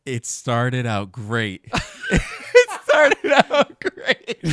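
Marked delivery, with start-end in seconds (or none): 1.7-2.5 a squeaky airy burst of laughter; high breathless laugh. Real, not acted. Friend laughing while judging the show, high and airy. Laughing at her own verdict. 2.5-4.4 slow words squeezed out through high loud laughter; laughing while speaking. Real, not acted. Friend laughing while repeating her verdict on the anime, high and loud.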